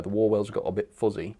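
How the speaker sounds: ordinary talk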